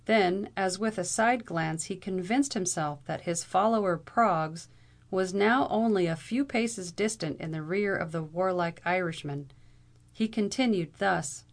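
ordinary delivery, nothing marked

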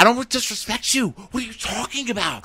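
high-pitched mocking imitation of him; mock whiny protest. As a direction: play the man protesting in a squeaky voice.

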